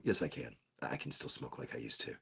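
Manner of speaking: low, very soft admission; quiet aside. Real, not acted. Taking back the joke in a low, quiet aside. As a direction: drop the act and say it under your breath. Drops act, contradicts himself under breath.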